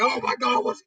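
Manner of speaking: mock panic. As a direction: freak out as the truth sinks in. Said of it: "panicking over his realization".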